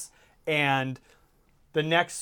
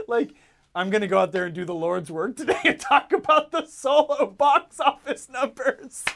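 mock grandiose; 6 semitones higher than usual. Mocking self-importance.

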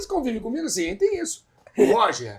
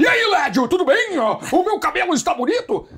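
raised, louder caricature of his own voice; mock imitation of himself; 7 semitones higher, 5 dB louder than usual. A hint of a caricature, mocking how the impersonator plays him. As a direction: ham up the vain TV-host parody of yourself. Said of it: "Não, não é assim".

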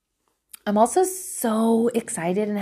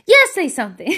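higher, emphatic inner push; insistent self-urging; 5 semitones higher, 4 dB louder than usual. Retold or imitated. Mother deciding to confront the worker, emphatic urging. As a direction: overrule yourself firmly. Inner argument, decisive urge wins.

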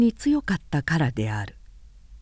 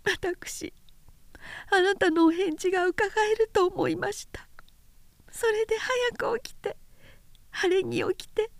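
tearful; 12 semitones higher, 7 dB quieter than usual. Acted. Weeping woman confesses.

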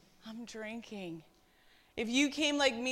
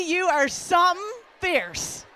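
calling out; 5 semitones higher, 6 dB louder than usual. Real, not acted. Calling out women by name.

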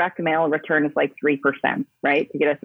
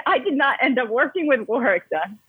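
usual speaking voice

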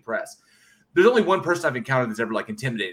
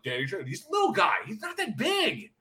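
cocky dismissal; 10 semitones higher, 4 dB quieter than usual. Retold or imitated. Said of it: "I was like".

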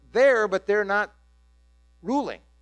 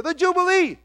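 higher, louder jubilant call; excited cry; 10 semitones higher, 7 dB louder than usual. Retold or imitated. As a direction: cry out the word like a cheering crowd. Crowd voiced climax.

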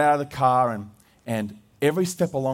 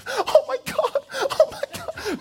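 much higher, squeaky laughing oh my God; imitating overwhelmed student midwife; slower than usual. Husband mimicking Bradley losing it at the birth, laughing high. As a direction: play the student nurse melting down, laughing helplessly. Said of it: "he was losing it".

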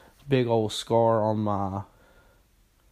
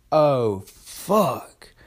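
sudden high, loud alarmed curse; shocked oh fuck; 3 semitones higher, 5 dB louder than usual. Retold or imitated. Friend finding the blood trail, alarmed shock. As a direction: jolt up at the sight. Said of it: "And then they go".

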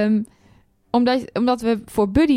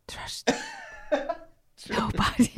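laughing whispered sign-off; 9 dB quieter, slower than usual. Shown as a demonstration. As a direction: whisper it through a giggle. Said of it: "je moet fluisteren".

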